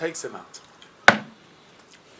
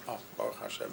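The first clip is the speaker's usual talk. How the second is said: ordinary talk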